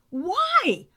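high-pitched incredulous outburst; real exasperation. Real, not acted. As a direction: demand an answer, pitch shooting up. Exasperated rant at the threat.

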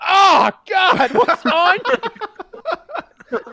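high, loud mock shout of revulsion; disgusted exclamation. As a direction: act out recoiling from something sticky. Retells Ricky touching sticky Randy.

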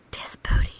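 hushed awed whisper; mock reverent whisper. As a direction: breathe it out in reverence. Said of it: "and it goes".

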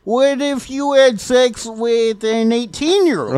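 put-on high, slow, sing-song Minnesota accent; mock Minnesota accent with a giggle. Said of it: "Your Minnesota accent is appalling".

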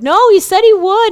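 insist, almost squealing; arguing with her boss, high insistent shout. Retold or imitated. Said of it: "And I'm like".

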